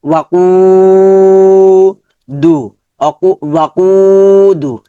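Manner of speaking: ওয়াকু with a drawn-out final vowel; elongated কু. Shown as a demonstration. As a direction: hold the উ of ওয়াকু long. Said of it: "লম্বা হবে".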